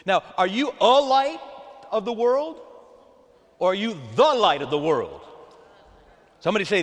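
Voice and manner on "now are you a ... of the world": "the" stressed against "a". Shown as a demonstration. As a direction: contrast "a light" with "the light". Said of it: "We can emphasize it".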